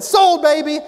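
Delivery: loud, high, triumphant cry; thrilled buyer. Retold or imitated. Happy customer buying the sandals, loud and triumphant. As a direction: shout it like you just scored a deal. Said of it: "I said".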